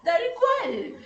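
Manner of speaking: high, loud words still laughing. Real, not acted. Host agreeing, still laughing at the joke. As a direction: agree while still cracking up.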